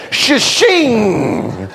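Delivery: swishing sword sound for a belt; belt drawn like a sword. Retold or imitated. A father yanks his belt off, swishing sword sound. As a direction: make the belt sing out of its scabbard. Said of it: "off comes the belt like a sword".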